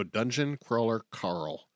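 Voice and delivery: "Dungeon Crawler Carl" said slowly, each word separate; slow careful. Shown as a demonstration. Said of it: "I have to pause and slowly go".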